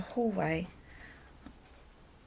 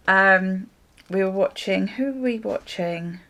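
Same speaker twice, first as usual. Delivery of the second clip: normal talking voice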